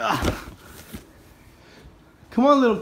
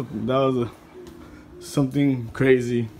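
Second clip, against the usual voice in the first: ordinary talk, nothing marked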